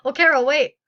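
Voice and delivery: loud call for her to stop; calling after her. Acted. Player loudly calling Caro back before she leaves. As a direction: shout after someone walking away.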